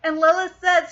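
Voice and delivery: normal talking voice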